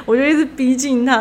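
ordinary talk

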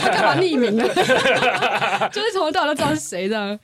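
loud laughing while talking; cracking up mid-sentence; laughing loudly. Real, not acted. Host cracking up at slip.

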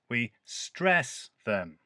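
slow, with "stress" pushed up and held. Shown as a demonstration. Teacher to students, stressing "stress" slowly. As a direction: lean on "stress", let the voice rise. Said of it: "Not only did I put more emphasis on the word, but my voice went higher".

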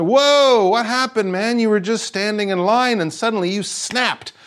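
high, startled mock reaction; surprised bystander. Bystander reacting to someone snapping, mock surprise. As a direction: act startled by a friend's outburst.

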